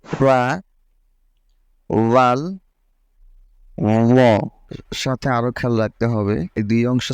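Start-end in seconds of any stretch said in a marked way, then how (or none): 0.1-4.2 ফা, রাল, র said with the tongue tip on the upper front teeth, light and thin; tongue tip on upper teeth, light articulation; thin, light Arabic ফা রাল র; Arabic letter demo. Shown as a demonstration. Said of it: "উচ্চারণটা বোঝার চেষ্টা করুন".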